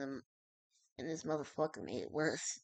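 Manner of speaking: plain reading voice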